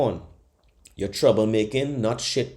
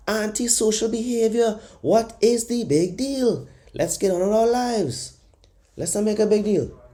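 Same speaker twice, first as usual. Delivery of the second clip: high, mocking voice playing down a scandal; mock Irish official; 11 semitones higher than usual. Mocking the Irish way of shrugging off violence, high and dismissive. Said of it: "That is the Irish way".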